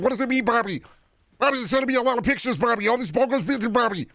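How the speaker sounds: a panicked high-pitched character pleading with Bobby; Deca voice. Acted. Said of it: "Deca voice is the real best voice".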